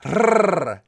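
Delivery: long trilled R, tongue tip buzzing on the palate; Spanish rolled R demo. Shown as a demonstration. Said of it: "You put your tongue there".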